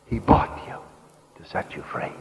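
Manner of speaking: breath-heavy drop, fading very soft; hushed refrain. Real, not acted. Preacher after the climax repeating the promise, hushed. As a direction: let the voice fall away almost unvoiced.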